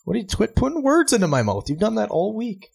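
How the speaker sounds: high-pitched outraged protest; protest, voice jumping up; indignant protest. Real, not acted. Son protests father teasing him.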